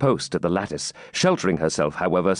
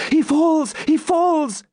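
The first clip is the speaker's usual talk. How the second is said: very high, louder exclamation; excited cry; 4 dB louder, slower than usual. Acted. Maiden sees the giant fall, exclaiming.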